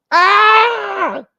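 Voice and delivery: high, loud piercing mock scream; kid's scream. Trick-or-treater biting the bad apple, mock scream. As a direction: let out a sharp cry of pain.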